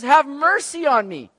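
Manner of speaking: loud, high, drawn-out cry; acted beseeching shout; pleading. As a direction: plead for mercy at full voice. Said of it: "coming to Jesus pleading just the mercy".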